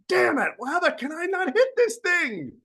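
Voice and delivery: frustrated golfer. Retold or imitated. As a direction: whine it like a golfer who just whiffed the ball. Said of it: "golfers all know what I'm talking about".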